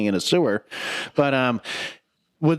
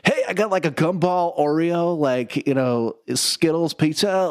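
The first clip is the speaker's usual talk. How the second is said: high, stretched-out surfer dude; excited goofy turtle pitching an idea; Michelangelo; 5 semitones higher, slower than usual. Retold or imitated. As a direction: imitate a drawn-out SoCal teen voice. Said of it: "he was like".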